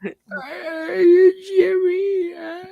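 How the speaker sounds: very high, slow cartoon whine; Carl. Calling Jimmy and his mom, high queasy whine. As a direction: drag it out in a queasy, high mock voice. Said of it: "That sounds more like Carl".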